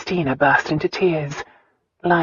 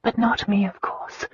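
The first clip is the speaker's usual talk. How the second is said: soft higher whisper, tender; 6 semitones higher, 4 dB quieter than usual. A narrator's reading. Christina telling Lyon her father loves her, in a whisper. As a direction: whisper it lovingly, almost to herself. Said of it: "Christina whispered".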